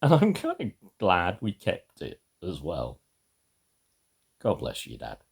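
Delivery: ordinary delivery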